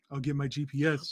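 soft, understated impression; Steve Gupta. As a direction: lightly imitate him under your breath. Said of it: "That's my best Steve Gupta impression".

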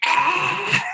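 very high mock shriek; long, hammed-up scream; screaming bloody murder. Other man sees the snake, screaming bloody murder. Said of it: "screaming bloody murder".

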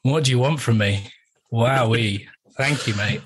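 ordinary delivery

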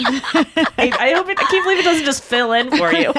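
amused, words bubbling with laughter; laughing while talking. Real, not acted. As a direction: talk through real giggles. Cracking up at search suggestion.

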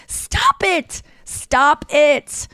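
high, drawn-out exasperated order; exasperated plea. Retold or imitated. Daughter telling parents to quit embarrassing each other, exasperated.

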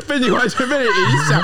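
laughing high through the words; giggling at her own slip; breaking up with laughter mid-sentence. Real, not acted.

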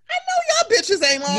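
high, loud laughing yell; laughing shout. Retold or imitated. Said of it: "I'm like".

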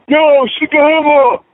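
high, slow shout-out from a fan dad; calling out. Retold or imitated. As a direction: call his name across the room, drawn out high. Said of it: "it's always the fathers".

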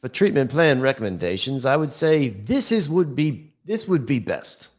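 a hint of Australian accent, as asked. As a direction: read it in a mild Australian accent. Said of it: "read that in a Australian accent".

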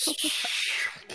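slow breathy whisper of disbelief; mock-stunned hushed whisper. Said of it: "And I was like".

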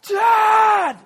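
imitated child's scream for a parent; very high, loud, drawn-out yell; top-of-voice scream. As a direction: scream it like a son calling from upstairs. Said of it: "scream at the top of his voice".

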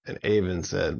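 usual speaking voice

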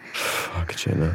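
hushed, unvoiced whisper of desire; breathless whisper. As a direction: breathe the words out barely voiced. Aroused gasp while going down.

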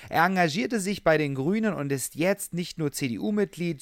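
ordinary delivery

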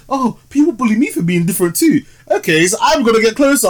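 hammed-up sweet talk to lure her closer; mock creepy clown. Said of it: "And he was like".

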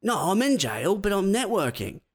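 high, fast, breezy upbeat prisoner; cheerful inmate faking success on his phone call. Pretend. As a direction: brush it off like everything's great. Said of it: "And he's like".

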